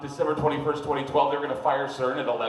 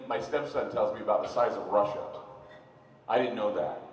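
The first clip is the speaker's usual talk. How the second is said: normal talking voice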